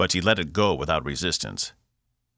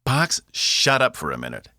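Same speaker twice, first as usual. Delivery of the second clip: higher-pitched irritated snap; snapped; 7 semitones higher, 4 dB louder than usual. A narrator's reading. Cass silences Box mid-question, snapped. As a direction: cut them off impatiently. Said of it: "Cass snapped".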